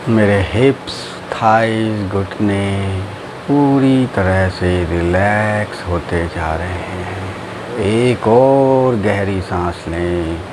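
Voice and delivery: low, slow drawn-out meditation voice; guided meditation. Acted. Teacher guiding friends through breathing and relaxing, guided meditation.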